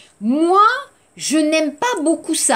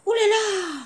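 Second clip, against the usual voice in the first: positive oh là là; 10 semitones higher, 4 dB quieter than usual. Shown as a demonstration.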